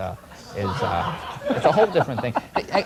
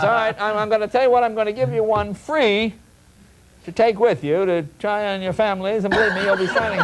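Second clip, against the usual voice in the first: eager salesman pitch; 6 semitones higher than usual. Retold or imitated. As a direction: sell it hard to a bored room. Salesman pleading with pharmacists.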